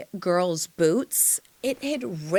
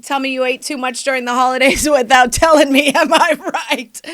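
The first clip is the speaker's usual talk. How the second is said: high, loud and rushed, breaking into laughter; loud joking comeback; 4 semitones higher, 9 dB louder than usual. Retold or imitated. Her reply to the dad, laughing it off loudly. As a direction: brazen it out with a quick laugh.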